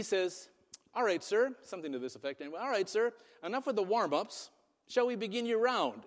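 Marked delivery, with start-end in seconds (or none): 0.9-1.5 raised, lightly British "all right, sir"; British accent. Retold or imitated. British caddy to the golfer, polite British accent. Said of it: "the accent to go with it". 2.6-6.0 higher, lightly British caddy voice; British accent. Retold or imitated. British caddy hands the golfer a ball, polite British accent. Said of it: "the accent to go with it".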